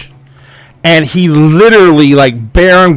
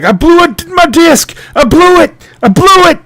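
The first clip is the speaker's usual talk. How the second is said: acted high-pitched pained cries; screaming in pain; 12 semitones higher than usual. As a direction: cry out as the injured man, pitched way up. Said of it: "he's, like, screaming pain".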